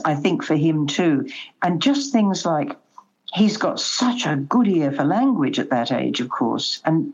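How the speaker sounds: usual speaking voice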